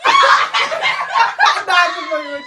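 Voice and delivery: high, loud, slow laughing protest; laughing shout. Real, not acted. Insisting to friends it's true, laughing and shouting loud. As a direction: shout the denial through laughter.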